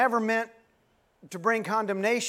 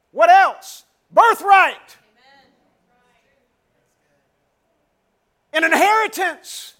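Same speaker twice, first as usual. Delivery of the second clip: louder and higher, calling out to the congregation; preaching at full force; 5 semitones higher, 10 dB louder than usual. Acted. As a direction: build the sermon to a ringing climax. Rising sermon climax.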